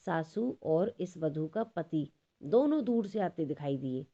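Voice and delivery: normal talking voice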